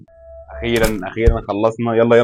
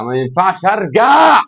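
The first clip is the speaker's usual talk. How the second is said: whiny drawn-out complaint; 9 semitones higher, 7 dB louder, much slower than usual. Real, not acted. Gamer whining he can't go back, slow and loud. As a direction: stretch it slow, high and loud in protest. Stuck in the game level, complaining.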